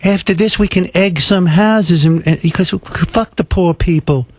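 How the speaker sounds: high, sarcastic put-on voice; mock prankster voice. Commentator mocking the star's prank plans, high sarcastic imitation.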